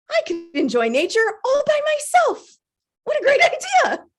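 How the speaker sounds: very high, giddy delight; thrilled with your own bright idea; gleeful enthusiasm. Acted. Acted delight at solo outing.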